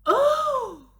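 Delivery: high loud joyful oh; Billy overjoyed at his dog; delighted cry. Acted. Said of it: "cried Billy".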